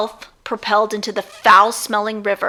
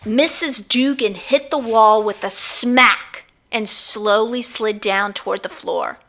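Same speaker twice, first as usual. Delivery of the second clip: ordinary delivery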